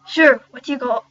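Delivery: put-on loud first stab at an Irish accent; rough put-on Irish accent; shaky Irish accent. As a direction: attempt Irish, not quite landing it. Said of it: "I have to solve this accent".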